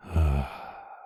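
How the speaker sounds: long falling sigh; drawn-out exhale at someone's tears; sympathetic sigh. Acted. Sighs on seeing listener cry.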